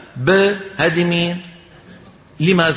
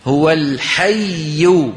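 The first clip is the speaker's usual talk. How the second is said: very slow "هو الحي", pressure on the doubled ي before the stop; drawn-out teaching demo; stressed stop on the doubled letter; much slower than usual. Shown as a demonstration. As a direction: hold and press the ي in "الحي", then stop falling. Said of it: "تأملوا، خليكم معي شوي".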